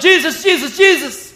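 high, loud repeated cries for help; hammed-up frantic calling; mock panic. Disciples waking Jesus in the storm, mock panic. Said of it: "Freaking out".

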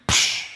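imitated hiss of doused flames; short, sharp "psh" of steam; water dousing fire. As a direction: make the sound of a fire going out.

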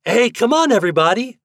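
high, loud call to the group; rallying shout. A narrator's reading. Toad calls the boys to the candy, shouting. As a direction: shout for everyone to gather. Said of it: "shouted Toad".

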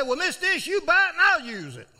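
high, loud cheeky offer; playful high-pitched dare to the boss; cheeky punchline. Retold or imitated. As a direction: toss it out like a cheeky grin. Joking reply to Mister Ish.

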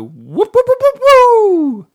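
high, loud, slow whoops; whooping. Retold or imitated. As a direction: whoop like an excited fan. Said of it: "that trailer did make me go".

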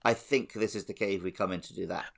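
ordinary talk, nothing marked

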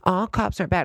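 high, sing-song mock of people; mocking quote. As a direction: parody them in a high put-on voice. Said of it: "these people that are just like".

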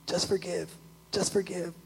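soft, hushed repeated plea; barely voiced urging to a family member; gentle plea. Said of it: "I'm like".

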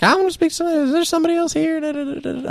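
very high mock-female voice; put-on female voice. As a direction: pitch it way up as an irate female customer. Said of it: "it's just the female voice I pulled out".

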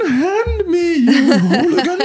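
a Victorian gentleman scolding a ruffian, played for laughs; Dickensian British accent. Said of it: "the Charles Dickens British accent".

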